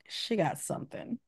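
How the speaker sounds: under-the-breath worried whisper; whispered aside. Retold or imitated. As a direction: breathe it out as a private thought. Inner thought about a sick girl.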